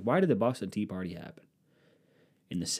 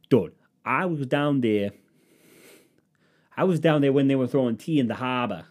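put-on loud, raised Boston-accent brag; 4 semitones higher, 5 dB louder than usual. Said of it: "Did J F K always sound like he was from the South? He was from Boston".

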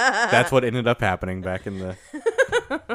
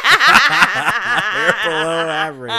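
slow, high, loud laughing echo; 9 semitones higher, 8 dB louder, much slower than usual. Real, not acted. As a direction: savor the joke through laughter. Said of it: "It's my favorite joke ever".

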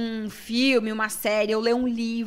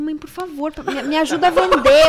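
pleading daughter; 5 semitones higher, 9 dB louder than usual. Retold or imitated. Pleading with mother to help sell.

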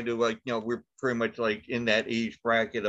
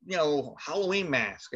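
ordinary delivery

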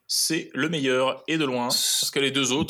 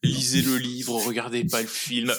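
usual speaking voice

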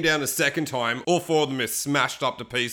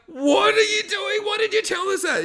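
outraged shout. Retold or imitated. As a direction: yell it at the friend who lied. Friends furious at Woody.

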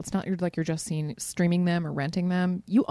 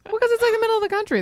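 excited rush; 14 semitones higher, 5 dB louder, faster than usual. Real, not acted. Excited reasoning.

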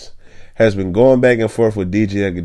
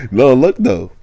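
ordinary delivery

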